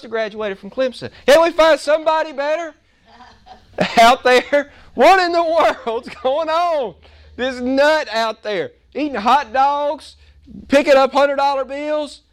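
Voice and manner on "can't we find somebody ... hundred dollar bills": exasperated viewer. Retold or imitated. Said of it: "But you think".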